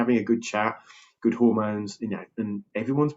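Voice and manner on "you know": ordinary talk, nothing marked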